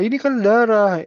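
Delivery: high, indignant retort; a father pointing at blood, upset; angry parent. Retold or imitated. Angry parent answers nurse.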